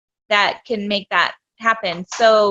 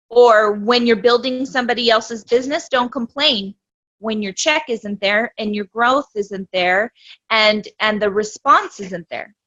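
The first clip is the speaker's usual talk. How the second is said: ordinary talk, nothing marked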